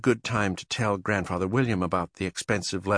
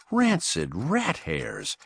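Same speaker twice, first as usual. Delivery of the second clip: dismayed exclamation; 8 semitones higher, much slower than usual. A narrator's reading.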